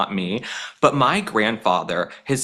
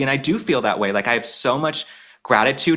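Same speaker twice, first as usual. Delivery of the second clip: ordinary talk, nothing marked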